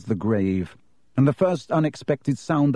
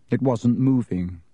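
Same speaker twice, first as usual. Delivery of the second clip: plain reading voice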